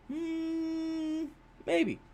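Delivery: mock doubtful.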